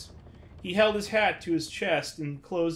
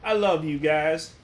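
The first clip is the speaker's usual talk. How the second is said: dying words; 5 semitones higher, 5 dB louder than usual. Acted. Dying friend's last words.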